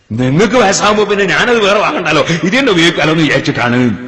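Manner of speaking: a fiery preacher driving the point home; emphatic preaching. Acted. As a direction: hammer the son's answer home to the crowd. Preacher hammers home the son's reply.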